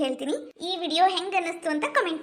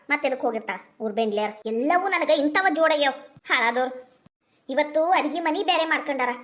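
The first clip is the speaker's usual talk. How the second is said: put-on lower, cranky old mother-in-law voice; grumbling old woman; 3 semitones lower than usual. Old woman cursing her daughters-in-law, lower and cranky.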